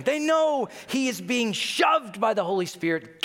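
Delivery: rising excitement at a soul being won; excited. Real, not acted. Preacher thrilled telling the congregation they know.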